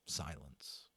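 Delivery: very soft, low hush; sudden near-whisper. A narrator's reading. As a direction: let the word fall into stillness. Sudden calm after battle.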